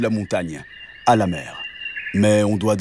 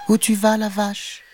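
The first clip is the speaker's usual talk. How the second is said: much higher curious kitten; little kitten voice; 13 semitones higher than usual. Acted. Kitten asks the cow where she's going, high kitten voice. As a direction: ask like a small kitten. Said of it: "demanda-t-il".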